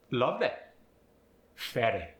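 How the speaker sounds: British lovely and fairy ending in EH; British accent, final Y as EH. Shown as a demonstration. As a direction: say 'loveh', 'faireh' in a British accent. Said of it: "turns to an E sound".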